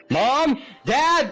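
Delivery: high, loud cry for parents; immediate yell. Acted. Leo calling his parents in sudden alarm, yelling. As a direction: yell out for help at full pitch. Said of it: "and immediately yells".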